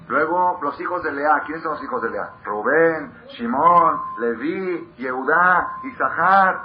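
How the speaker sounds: loud, high preaching roll call; booming list of the sons; emphatic listing. Acted. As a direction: call out each name like a proclamation.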